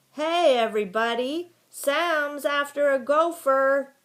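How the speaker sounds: high, loud holler; boy's yell. A narrator's reading. Boy calling everyone to the dog's chase, yelling. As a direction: yell it out to rally the whole crowd. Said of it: "a boy yelled".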